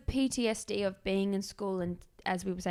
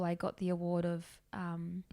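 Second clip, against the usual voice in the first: ordinary delivery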